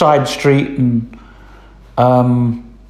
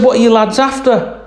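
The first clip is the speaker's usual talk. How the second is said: farmer's wife; 10 semitones higher than usual. Retold or imitated. Farmer's wife voiced high.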